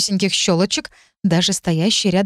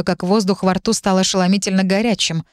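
ordinary delivery, nothing marked

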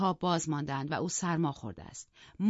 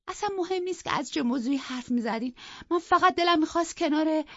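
upset pleading; 11 semitones higher, 5 dB louder than usual. Acted. Isabella pleading, cut off.

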